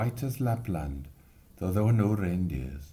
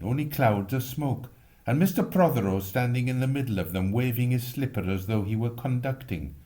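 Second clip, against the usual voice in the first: ordinary delivery, nothing marked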